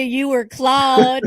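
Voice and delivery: drawn-out American Claude, slow and stretched; American accent. Retold or imitated. Teasing friend Tyler's American way of saying Claude, slow American accent. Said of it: "And that is an American accent".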